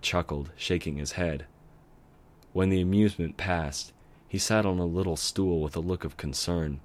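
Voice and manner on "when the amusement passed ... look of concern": ordinary delivery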